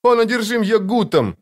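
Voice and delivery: very high cried warning; acted high-pitched alarm; exclaiming. A narrator's reading. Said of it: "воскликнула женщина".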